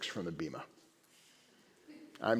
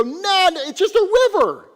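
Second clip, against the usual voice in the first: much higher, louder sneering students; mocking dismissal; 10 dB louder than usual. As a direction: brush off the miracle in a high scoffing voice. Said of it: "They go".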